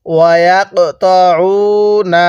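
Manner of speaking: slow drawn-out tajweed recitation; Quranic recitation. Shown as a demonstration. Teacher leading students to repeat, slow recitation. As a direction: stretch each syllable for the class to repeat. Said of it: "হ্যাঁ, বলুন".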